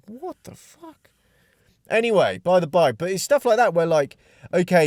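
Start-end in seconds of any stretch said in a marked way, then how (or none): 0.1-1.1 high, breathy stunned what the fuck; half-voiced gasp of disbelief. Stunned reaction in retold story.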